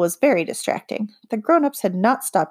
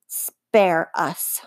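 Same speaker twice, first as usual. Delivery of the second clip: huffy; 3 semitones lower than usual. A narrator's reading. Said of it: "huffed the queen".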